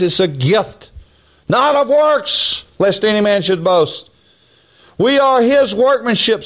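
high, ringing pulpit delivery; preaching. Acted. Preacher building up the congregation, full preaching voice.

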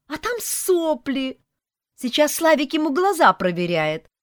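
put-on high, loud, joyful kid reporting; gleeful children. A narrator's reading. Children report doctor game to mom, gleeful. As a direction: call to mom with bubbling childish glee. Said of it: "Как-то очень радостно доложили нам".

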